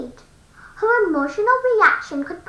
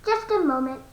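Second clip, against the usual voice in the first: normal talking voice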